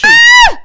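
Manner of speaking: very high, loud shriek; startled scream. Acted. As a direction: shriek in sudden fright. Attacked by doll, screams.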